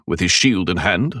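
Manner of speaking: ordinary delivery, nothing marked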